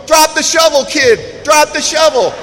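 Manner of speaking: loud, high-pitched imitation of a pleading sponsor; urgent warning. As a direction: call out a mock-urgent warning. Sponsor's warning voice in his head.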